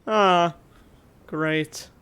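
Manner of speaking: ordinary talk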